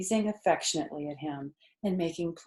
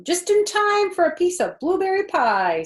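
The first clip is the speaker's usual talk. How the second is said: high, louder farm wife greeting; Mrs Zuckerman; 12 semitones higher, 8 dB louder than usual. A narrator's reading. Farm wife greeting the children, higher and louder. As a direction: welcome the kids to pie, voice pitched up. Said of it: "said Missus Zuckerman".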